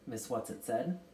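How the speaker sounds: ordinary delivery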